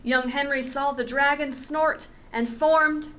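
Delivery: ordinary delivery, nothing marked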